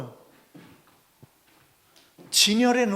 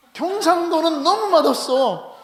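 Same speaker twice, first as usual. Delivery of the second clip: high, slow, drawn-out grumble about bland food; exaggerated complaint; 8 semitones higher, 6 dB louder, slower than usual. Preacher mocking Gyeongsang food to the congregation, higher and slow. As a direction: whine it like a food snob.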